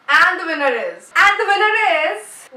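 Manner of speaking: loud, high mock announcer, building; hammed-up winner announcement. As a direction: proclaim it big, stretching for suspense. Mock drum-roll winner announcement.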